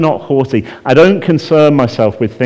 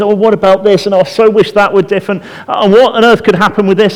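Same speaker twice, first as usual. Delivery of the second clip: mock anxious fretting; 8 semitones higher, 4 dB louder than usual. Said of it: "the anxiety is".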